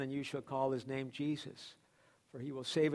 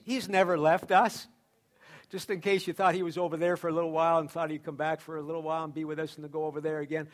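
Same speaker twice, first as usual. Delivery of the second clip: ordinary talk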